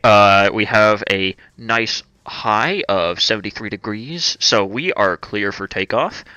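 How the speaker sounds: captain greeting passengers, a bit slow; airplane pilot. Pretend. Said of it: "sounds like an airplane pilot".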